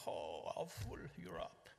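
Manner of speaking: slow, hushed mock lament; mock dismay. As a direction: imitate a dismayed reaction, drawn out and soft. Mocking imagined American reaction to Europe.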